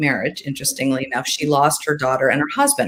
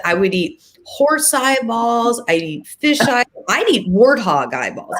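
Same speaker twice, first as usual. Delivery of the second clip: put-on boasting child; 6 semitones higher, slower than usual. Eleven-year-old son boasting.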